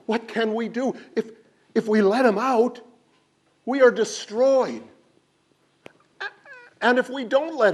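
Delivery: high, loud frantic wailing; panicked crowd. A narrator's reading. Villagers panic over the shrinking bag, high frantic wail. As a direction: wail in despair. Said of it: "the people were beside themselves".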